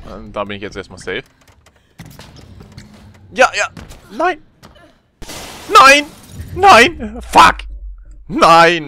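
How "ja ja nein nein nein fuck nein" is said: rising yells of no; shouting. Real, not acted. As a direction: shout in frustration. Wallrun fails, shouting.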